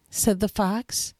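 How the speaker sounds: usual speaking voice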